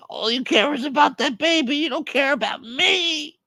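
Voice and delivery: very high mock-crying whine; hammed-up tearful guilt trip; jealous wailing. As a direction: wail it like a neglected parent. Caller wails jealously at hang-up.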